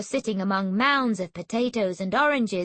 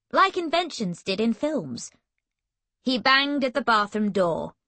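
ordinary delivery

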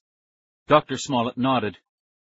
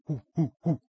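short rising ape hoots; chimp hoots; 5 semitones higher than usual. Acted. Chimp greets the children, hooting. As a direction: hoot like a chimp. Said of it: "He made chimp noises".

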